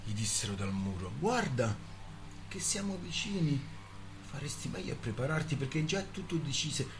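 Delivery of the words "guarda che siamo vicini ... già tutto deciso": sardonic, mocking voice from the wall; higher, sneering and sardonic. A narrator's reading. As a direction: taunt him with cold irony. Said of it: "La voce era sardonica, irridente".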